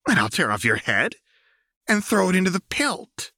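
high, fierce warning with rising heat; furious threat. Acted. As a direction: threaten him with fury. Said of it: "stared him down with wide, furious eyes".

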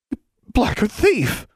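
high, slow, outraged accusation. A narrator's reading. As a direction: spit out the insult. Rebecca outraged at theft.

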